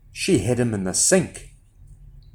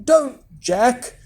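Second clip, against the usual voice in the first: high, pleading mother; mildly anxious plea, pitched up; begging; 10 semitones higher than usual. A narrator's reading. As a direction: beg your son to stay. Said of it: "begged his mother".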